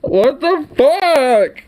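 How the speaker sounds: high, slow, stretched-out cry of disbelief; incredulous outburst. Real, not acted. Streamer reacting to the replayed clip, incredulous outburst. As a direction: draw it out, utterly floored.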